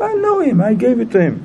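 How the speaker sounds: high-pitched retold insistence; imitated claimant's protest; acted insistent protest. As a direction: insist on it, starting high and falling. Retold lender insisting on his claim.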